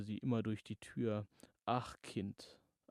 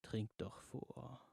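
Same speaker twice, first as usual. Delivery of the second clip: whisper; 6 dB quieter, slower than usual. Acted. Creature voice tempting her.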